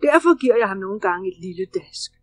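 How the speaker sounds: loud, firm bear voice, laying down the law; booming teacher bear who won't be argued with; stern bear. Acted. Baloo firmly tells Bagheera the cub must learn, loud and stern. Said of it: "Men Baloo svarede".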